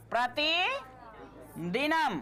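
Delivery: प्रतिदिनम drawn out slowly in two parts, long pause after प्रति; slow teacher's dictation of प्रतिदिनम, broken mid-word; slow split dictation. Shown as a demonstration. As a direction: dictate प्रतिदिनम piece by piece, प्रति rising, then दिनम. Teacher repeats word slowly for writing.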